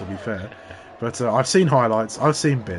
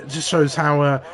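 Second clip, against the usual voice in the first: ordinary delivery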